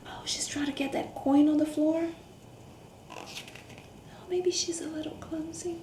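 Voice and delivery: hushed, confiding whisper. Acted. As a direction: whisper as if not to be overheard. Whispering observations to children.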